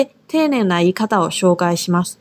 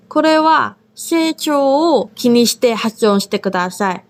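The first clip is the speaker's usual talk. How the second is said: ordinary delivery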